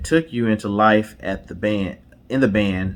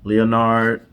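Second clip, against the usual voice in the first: slow, dramatic reveal read like a trailer; announcer reveal; 2 semitones higher, 5 dB louder, slower than usual. Acted. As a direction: announce the movie credits with a show host's flourish. Dramatic reveal of movie credits.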